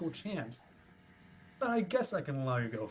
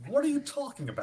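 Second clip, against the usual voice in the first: much higher and louder, thrown off balance; alarmed; 11 semitones higher, 4 dB louder than usual. Acted. Guard baffled as Willow presses him, high and loud alarm. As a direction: caught completely off guard.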